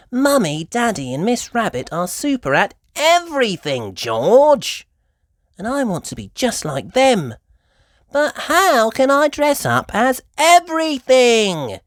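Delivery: put-on high, loud little girl; puzzled, asking Mummy for help; Peppa's voice. A narrator's reading.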